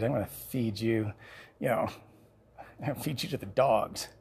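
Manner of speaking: Goliath mocking David from above; taunting giant. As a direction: sneer down at the boy.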